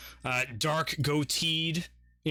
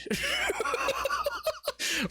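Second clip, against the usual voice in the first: shrieking laughter. Real, not acted. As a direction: cackle uncontrollably. GM laughs wildly.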